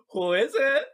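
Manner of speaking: high, loud call through a door. Pretend. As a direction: yell it to whoever is outside. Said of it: "I call out".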